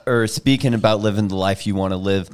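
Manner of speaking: ordinary delivery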